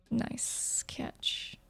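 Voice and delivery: soft hissing whisper; snake voice. A narrator's reading. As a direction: breathe it out like a snake. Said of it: "the snake's voice oozes with deception".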